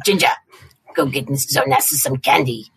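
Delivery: ordinary delivery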